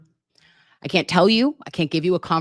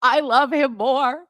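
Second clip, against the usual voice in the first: gushing; 8 semitones higher, 4 dB louder, slower than usual. Real, not acted. Gushing about the attorney.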